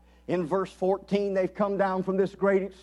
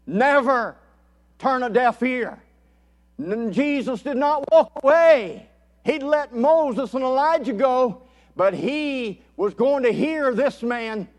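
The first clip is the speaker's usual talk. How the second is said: higher, louder pulpit proclamation; forceful preaching; 5 semitones higher, 6 dB louder than usual. Acted. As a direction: declare it with rousing conviction.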